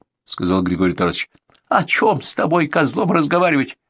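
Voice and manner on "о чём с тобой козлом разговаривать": high-pitched angry contempt; scornful outburst. A narrator's reading. Bandit scorns the former commander's offer, high and angry. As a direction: spit back the refusal, high and heated.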